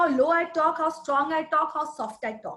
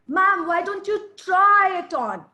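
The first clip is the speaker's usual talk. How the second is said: louder, slower urging with stress on try; 2 semitones higher, 5 dB louder than usual. Shown as a demonstration. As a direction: coax her to try the ring, leaning on try. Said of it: "I'm urging her to try it on".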